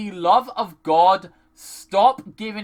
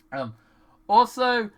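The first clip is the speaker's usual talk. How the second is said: usual speaking voice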